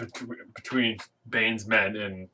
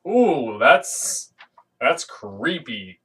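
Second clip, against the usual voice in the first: creeped out; 4 dB louder, much slower than usual. Retold or imitated. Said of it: "I was like".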